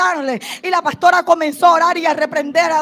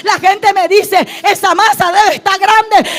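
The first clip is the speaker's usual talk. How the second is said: full-force preaching; 3 semitones higher, 8 dB louder than usual. Acted. Preacher declaring to the congregation her faith in Jehová, full-force shout. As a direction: build to a defiant, triumphant cry of faith.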